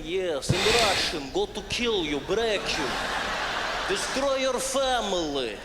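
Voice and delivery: slow, heavy Russian menace; menacing Russian accent. Acted. Comic showing how Russians sound dangerous, menacing Russian accent. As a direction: threaten slowly in a thick Russian accent. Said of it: "Everything the Russians say sounds dangerous and menacing".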